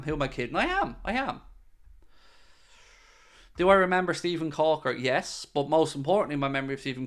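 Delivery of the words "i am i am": excited. Real, not acted. Eager admission after "who am I kidding.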